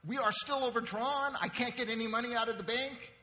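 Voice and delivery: loud, high disbelieving outburst; upset wife. Acted. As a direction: play a wife incredulous at her husband. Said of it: "And the wife goes".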